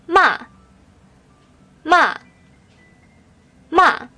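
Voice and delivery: "ma" starting high and falling sharply, fourth tone; falling-tone "ma" like a curt command. Shown as a demonstration. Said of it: "The last tone is a falling tone".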